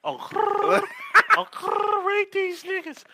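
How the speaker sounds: very high, loud squealed exclamation; mock outrage. Real, not acted. Host reacting high and loud to the [name] joke. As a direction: shriek it high in mock shock.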